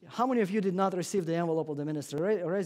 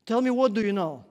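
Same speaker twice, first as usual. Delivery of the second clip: pleading question; 5 semitones higher, 9 dB louder than usual. Retold or imitated. Policeman's last question in story.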